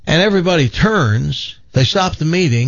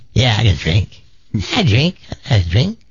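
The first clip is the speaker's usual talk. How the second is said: tough granny; 3 semitones lower than usual. Acted. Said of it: "Throws the bottle down".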